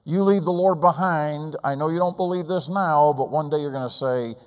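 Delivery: high, loud pulpit appeal; fiery preaching. Acted. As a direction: plead with the congregation at full force. Preaching at full force.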